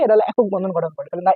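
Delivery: ordinary talk, nothing marked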